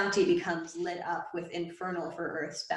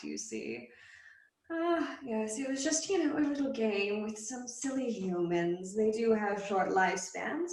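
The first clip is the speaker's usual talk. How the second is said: teasing fiend; 4 semitones higher than usual. Acted. The fiend taunting the possessed boy, amused and high. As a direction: savour every word, toying with him.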